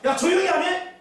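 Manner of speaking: high, loud retold rebuke; angry hushing. Crowd silencing the blind man, loud mock scolding. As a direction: act out a sharp order to shut up.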